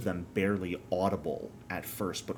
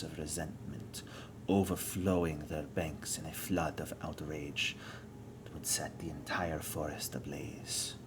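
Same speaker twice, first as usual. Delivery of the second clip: villain voice; 6 dB quieter than usual. Acted. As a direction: menace them softly, almost whispering. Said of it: "Why do you sound like a villain?".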